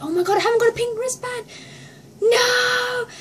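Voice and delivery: high, fast panic into a long wail; panic, then wail in dismay; hammed-up fan panic; worried panic. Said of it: "I got very, very worried".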